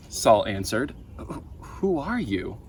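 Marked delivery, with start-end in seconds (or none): 1.2-2.6 frightened stammer. A narrator's reading. Saul stammers in fear.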